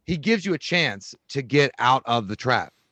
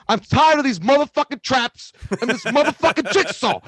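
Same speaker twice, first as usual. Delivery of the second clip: loud, high shouting Sam Jackson; Sam Jackson impression; 11 semitones higher, 6 dB louder than usual. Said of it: "he goes".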